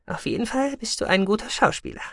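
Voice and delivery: amused female figure. A narrator's reading. Sahira praising Goloshir's acting after the bluff, amused. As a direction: lift the voice high, teasing compliment.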